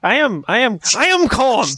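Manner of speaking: rising, high-pitched protest of calm; mock-agitated insistence. Told to calm down by co-host, agitated insistence.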